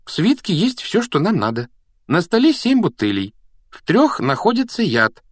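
put-on high-pitched, excited girl's explanation; eager clever Hermione. A narrator's reading. Hermione tells Harry it is logic, excited. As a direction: voice a keen know-it-all, pitched well up.

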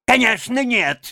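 high, gleeful Toad reply; cheerful. A narrator's reading. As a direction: chirp it back merrily. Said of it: "весело откликнулся Жаби". (весело, высоким голосом)

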